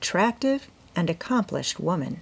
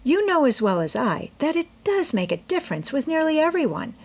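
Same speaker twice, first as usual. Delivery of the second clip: put-on young girl's voice, pitched higher, earnest; eager young woman explaining her plan to her mother; Amy's voice; 4 semitones higher than usual. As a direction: plead your case brightly as the eager daughter. Amy answers mother.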